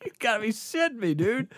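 high-pitched, laughing incredulity; retold giggly disbelief; amused disbelief. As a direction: react as if it must be a joke. Retold disbelief at the funny diagnosis.

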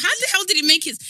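high, loud outraged question; loud disbelief. Retold or imitated. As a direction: blurt it out in disbelief. Outraged quote.

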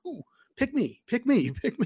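high, eager hand-raising squeal; eager pick me. Retold or imitated. Podcaster on the bus tour begging the guide to pick him, high and eager. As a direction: beg to be picked like a kid in class. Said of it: "And I'm like".

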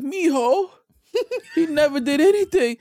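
put-on high, doting grandma defending her boy; sweet grandma. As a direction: coo it like a grandma on the news. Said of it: "the sweetest grandma that's on the news".

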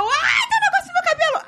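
high shrieking panic, half laughing; frantic laughing shriek about the hair; panicked shriek. Retold or imitated. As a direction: scream it as the roach runs into your hair. Panicked scream about bug in hair.

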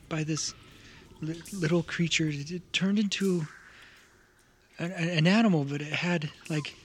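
lightly voiced frightened kid, halting and stammering; a young survivor stumbling through the story; grieving child tells of the attack; scared child.

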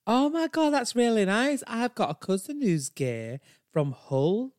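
put-on high, gushing Yorkshire accent; Brenda. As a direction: overfamiliar Yorkshire woman, delighted. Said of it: "she has such a strong Yorkshire accent".

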